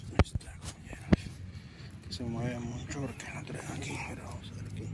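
hushed whisper while digging. Real, not acted. Showing the find to viewers close up, whispering. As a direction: breathe the words out, no voice.

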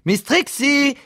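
very high, stretched call; piercingly high summons; insistent calling out. Acted. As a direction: call her name insistently at the top of your range. Boss calls again, more insistently.

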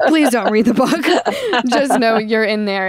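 embarrassed pleading through real laughter; laughing plea. Real, not acted. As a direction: beg them off while laughing. Laughing plea not to read book.

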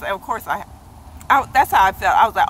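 normal talking voice

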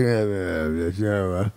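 low, soft mumbled uhs; imitating a lazy mumbler; mumbling. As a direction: mumble with no wind behind it.

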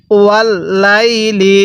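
slow ওয়াল্লাইলি with held l and long লাই; read-along recitation. Shown as a demonstration. Teacher has learners repeat after them, slow drawn-out ওয়াল্লাইলি. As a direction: stretch the middle of ওয়াল্লাইলি evenly. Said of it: "আরেকবার পড়ুন".

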